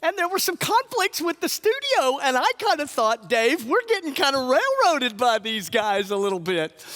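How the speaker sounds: high, loud, pushed voice; loud outburst. Acted. Comic outburst about studio conflicts.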